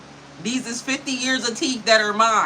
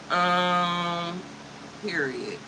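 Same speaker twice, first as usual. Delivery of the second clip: ordinary delivery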